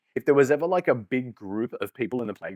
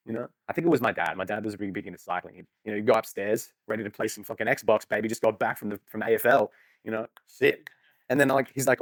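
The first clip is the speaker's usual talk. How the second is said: ordinary talk, nothing marked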